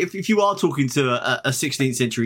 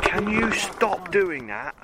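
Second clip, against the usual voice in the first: annoyed editor; 5 semitones higher, much slower than usual. Retold or imitated. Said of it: "Editor Reggie will turn up and just be like".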